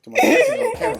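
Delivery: high, loud, fast laughing jab; laughing tease. Real, not acted. Boxer dodging the host's age question, laughing tease. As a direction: laugh it off playfully. Teasing dodge about his age.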